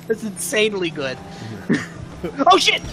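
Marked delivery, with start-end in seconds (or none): none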